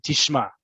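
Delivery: "Tishma" landed on the last syllable, no uptalk; falling ending. Shown as a demonstration. Teacher shows student how to demand attention, "Tishma" falling at the end. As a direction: say "Tishma" as a firm statement that drops at the end. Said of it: "that's how you're gonna say it".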